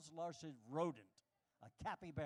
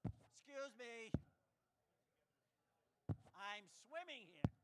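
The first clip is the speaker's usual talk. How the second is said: mock polite swimmer; 5 semitones higher, slower than usual. Polite swimmer addressing shark, slow.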